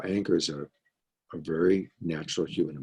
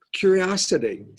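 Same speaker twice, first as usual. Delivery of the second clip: bright announcement; 11 semitones higher, 5 dB louder than usual. Shown as a demonstration. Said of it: "do my Scott spiel on it".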